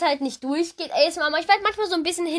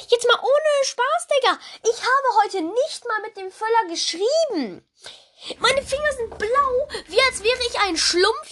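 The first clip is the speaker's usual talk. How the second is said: disbelieving exclaim; 7 semitones higher than usual. Real, not acted. Shocked about his blue fingers.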